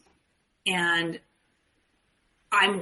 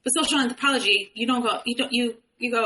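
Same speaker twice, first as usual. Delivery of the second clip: usual speaking voice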